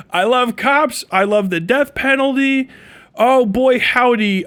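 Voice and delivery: hammed-up gung-ho juror; fake enthusiasm. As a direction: lay on the eagerness to get picked. Lying eagerly to get picked.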